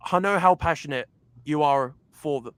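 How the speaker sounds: ordinary talk, nothing marked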